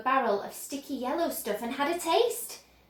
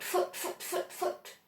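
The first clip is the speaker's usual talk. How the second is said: machine noise; 4 semitones higher, 8 dB quieter than usual. Acted.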